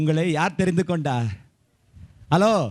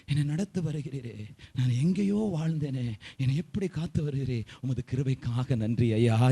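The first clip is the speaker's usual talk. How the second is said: soft, lowered prayer of gratitude; tearful thanksgiving; 4 semitones lower, 5 dB quieter than usual. Shown as a demonstration. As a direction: demonstrate quietly pleading thanks to God. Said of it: "எப்படி அழுவேன் தெரியுமா?". (கண்ணீரோடு நன்றி சொல்லும் மென்மையான ஜெபம்)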